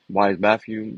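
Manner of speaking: normal talking voice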